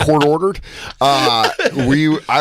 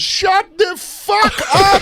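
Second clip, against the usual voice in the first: much higher, very slow put-on yell; imitating prank caller; much slower than usual. Prank caller telling off the operator. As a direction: stretch it out in a squeaky voice. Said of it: "He goes".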